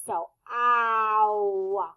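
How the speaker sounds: "ow" drawn out slowly, mouth open wide then closing; slow exaggerated ow. Shown as a demonstration. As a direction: stretch "ow" from wide open to closed. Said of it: "starts wide open and then it closes".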